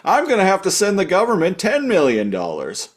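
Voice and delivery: high-pitched indignant rant; mock exasperated boss. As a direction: play an outraged boss footing the bill. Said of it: "bitching them out".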